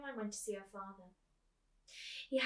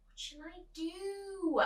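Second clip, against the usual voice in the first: slow, high, drawn-out bored whine; 10 semitones higher, 4 dB louder, slower than usual. Acted. Bored girl repeats her question to her busy mother, whiny. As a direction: drag it out like a bored child. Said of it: "repeated Coraline".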